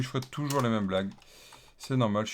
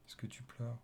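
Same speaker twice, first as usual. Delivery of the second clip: coaxing hush; 12 dB quieter than usual. Acted. Coaxing the crying app.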